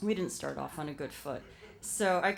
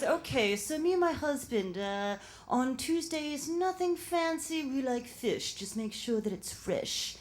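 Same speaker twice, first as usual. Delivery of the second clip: put-on upper-crust mother accent; 7 semitones higher than usual. Employer instructing supposed chef on dinner, high upper-crust accent. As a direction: issue orders in a lofty upper-crust accent. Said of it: "It reminded me of, uh, Harold and Maude".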